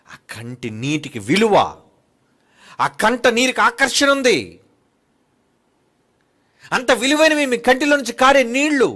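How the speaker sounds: loud, high pulpit exhortation; fiery preaching. Acted. Preacher urging listeners to weep only for God, preaching loudly. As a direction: drive it home like a revival preacher.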